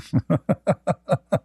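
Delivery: drawn-out chuckle in short bursts; laugh. Real, not acted. Host tickled by his own impression, laughing. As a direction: chuckle at your own joke.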